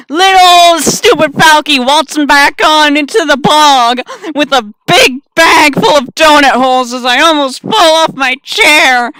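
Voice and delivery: very loud, high-pitched holler; boisterous declaration. Acted. Player declaring Falky's return to the bog, boisterous holler. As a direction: belt it out with reckless bravado.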